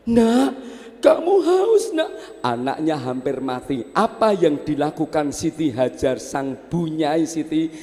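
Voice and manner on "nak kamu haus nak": high, louder cry; anguished high cry over the child; desperate mother. Acted. Mother cries to dying baby, high and loud.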